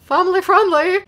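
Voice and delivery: ordinary delivery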